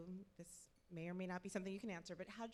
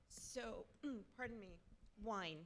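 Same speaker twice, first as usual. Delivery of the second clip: clearing a choked throat; recovering from a wrong swallow; choking; 3 semitones higher, 3 dB quieter than usual. Real, not acted. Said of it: "wine. Wrong pipe".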